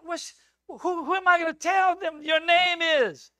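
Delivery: high, loud and rushed, near breaking; a frightened man protesting his mission; panicked Moses. Acted. Moses begging God, high and loud in alarm.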